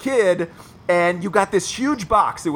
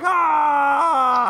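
mock gleeful yell; 8 semitones higher than usual. Imitating friend cheering at crying.

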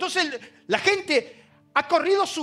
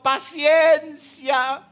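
long drawn-out patience; 3 semitones higher, 5 dB louder, much slower than usual. Acted. Pastor repeating answer, drawn out.

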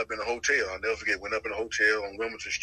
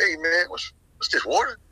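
incredulous; 6 semitones higher, 4 dB louder than usual. Retold or imitated. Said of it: "I was like".

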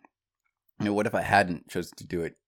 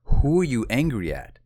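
insistent question, louder and higher; pressing coach; 5 semitones higher, 6 dB louder than usual. Retold or imitated. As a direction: press hard for an answer. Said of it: "he pressed me".

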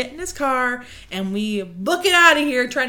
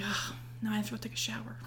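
fast unvoiced whisper; 15 dB quieter, faster than usual. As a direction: whisper the complaint quickly. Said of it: "I was like".

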